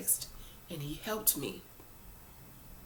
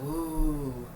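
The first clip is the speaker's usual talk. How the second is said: weak cub growl; 3 semitones lower than usual. Retold or imitated. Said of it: "And he was like".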